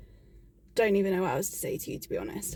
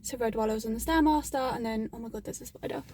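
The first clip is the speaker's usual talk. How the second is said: normal talking voice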